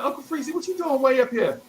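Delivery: high-pitched mock astonishment; surprised greeting. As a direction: voice shoots up, imitating someone surprised to see you. Older guy surprised to find boy.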